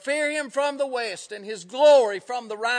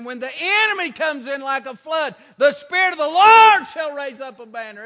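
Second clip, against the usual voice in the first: raised, forceful scripture cry; preaching shout; 2 semitones higher, 9 dB louder than usual. Real, not acted. Preacher quoting scripture to the congregation, shouted with force. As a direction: build to a loud peak on the Lord.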